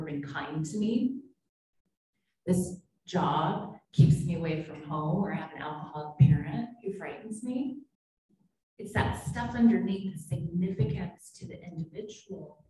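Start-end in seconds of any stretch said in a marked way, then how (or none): none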